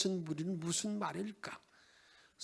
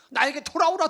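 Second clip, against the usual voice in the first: louder call; 8 semitones higher, 11 dB louder than usual. Acted. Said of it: "그렇게 말합니다".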